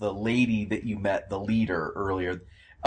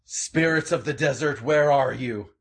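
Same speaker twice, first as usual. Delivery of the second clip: calling out; 5 semitones higher, 4 dB louder than usual. Acted. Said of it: "calls out".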